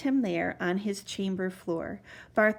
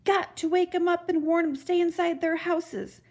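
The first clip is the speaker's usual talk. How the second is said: mild shout; 7 semitones higher than usual. A narrator's reading. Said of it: "he shouted".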